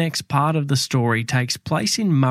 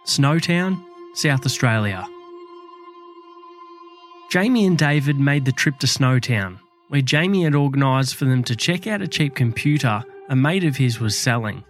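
true crime narrator. A narrator's reading. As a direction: tell it like a dark true crime tale.

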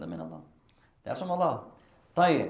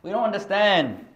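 questioning students; 8 semitones higher, 7 dB louder than usual. Retold or imitated. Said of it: "they said".

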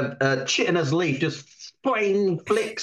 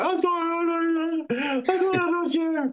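frantic apology; 13 semitones higher than usual. Acted. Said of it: "I go".

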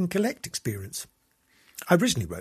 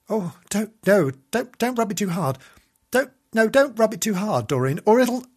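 higher, louder panicky warning; mounting alarm; 7 semitones higher, 6 dB louder than usual. Acted. Host warns Doreen not to rub it too hard, mounting alarm. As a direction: stammer a frantic warning, building as it goes.

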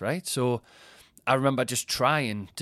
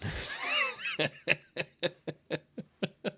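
long, high helpless laugh; 10 semitones higher, 4 dB quieter than usual. Real, not acted. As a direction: crack up at your own story. Cracks up at his own story.

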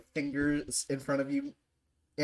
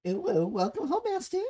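much higher, cutesy put-on voice; cutesy anime greeting. Friend parodying the redeem greeting, high cutesy anime voice. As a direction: ham up a high-pitched anime welcome.

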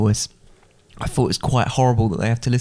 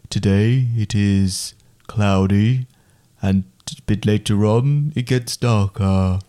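slow, low weatherman voice; deep, slow weather forecast; deeper weather voice; 2 semitones lower, much slower than usual. Shown as a demonstration. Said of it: "the deeper one".

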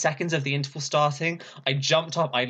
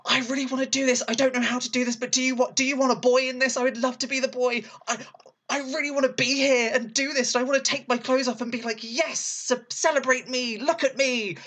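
much higher, breathless eager gushing; eager screaming; 11 semitones higher than usual. Retold or imitated. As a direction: beg the company head to let you join, bursting with excitement. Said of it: "I started screaming at her".

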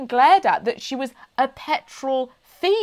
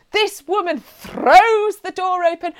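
usual speaking voice

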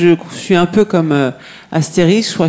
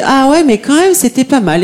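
high, louder mock-thrilled inner thought; excited inner voice; 11 semitones higher, 3 dB louder than usual. Young intern recalling her excitement about events, high and thrilled. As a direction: replay her own delighted reaction. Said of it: "je me dis".

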